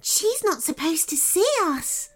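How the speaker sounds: high little fairy, a touch worried; lightly voiced fretting fairy. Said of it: "said a second".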